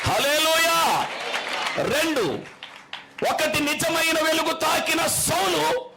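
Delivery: long, high, ringing hallelujah; hallelujah shout. Real, not acted. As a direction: call it out slowly to the hall. Preacher calls out to congregation.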